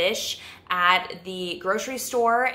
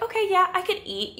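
much higher, breezy and offhand; casual shrug; 12 semitones higher than usual. Retold or imitated. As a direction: toss it off like hunger barely registers. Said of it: "you're just like".

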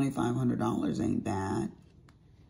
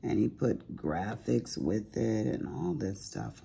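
ordinary delivery

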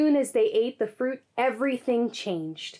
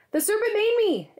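high, louder defensive exclaim; 12 semitones higher, 4 dB louder than usual. A narrator's reading. Eve blaming the serpent to God, a high exclaim. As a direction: blurt it out high to shift the blame. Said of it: "exclaimed Eve".

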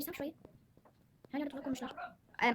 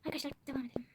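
whisper; 4 dB quieter, faster than usual. Real, not acted. Whispers to viewers, noisy siblings nearby.

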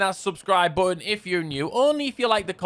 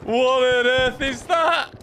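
amazed; 8 semitones higher, slower than usual. Real, not acted. Amazed at what just happened.

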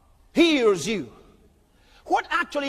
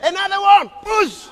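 loud, higher commanding devil; devil's shouted order; 6 semitones higher, 9 dB louder than usual. Acted. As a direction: bark it like a devil sending demons.

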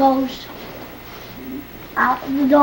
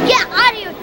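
shooing a sibling; 8 semitones higher, much faster than usual. Real, not acted. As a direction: tell him to go away. Child shoos brother away.

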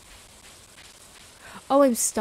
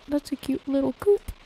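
voice crack; 3 semitones higher, 4 dB louder than usual. Real, not acted. Gushing at a little coop, voice cracking. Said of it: "I'll let my voice crack".

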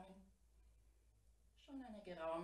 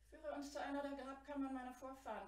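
higher, louder royal command; the prince's loud, higher command; 6 semitones higher, 6 dB louder than usual. A narrator's reading. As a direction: order the ape like a prince. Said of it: "befahl der Jüngling".

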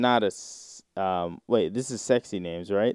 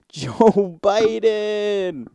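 very slow, higher moan through a laugh; mock moan; 10 semitones higher, 4 dB louder, much slower than usual. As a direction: moan the name out, half laughing. Moaning names read from sex thread.

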